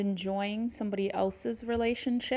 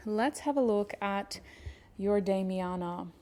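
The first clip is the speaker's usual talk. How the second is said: ordinary talk, nothing marked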